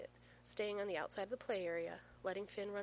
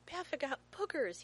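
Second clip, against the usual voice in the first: put-on high, loud little-boy crowing; gleeful child's cackle; 8 semitones higher, 5 dB louder than usual. A narrator's reading. Small son teasing dad, cackling with glee. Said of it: "he cackles".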